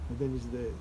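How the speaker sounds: ordinary talk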